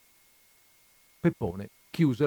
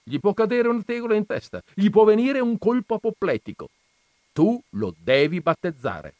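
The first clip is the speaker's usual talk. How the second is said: urgent command; 12 semitones higher, 8 dB louder than usual. A narrator's reading. Jesus ordering Don Camillo to baptize the child, loud. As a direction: press him hard, then lay down the law.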